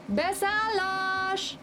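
high, long drawn-out boarding cry; conductor's call. A narrator's reading. Conductor summons passengers aboard the train, drawn-out call. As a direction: call it out in a stretched singsong. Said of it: "a kalauz nagyot kiáltott".

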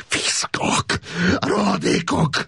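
high, slow sobbing curse; crying out. A narrator's reading. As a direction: break into tears on the insult. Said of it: "sírva fakadt".

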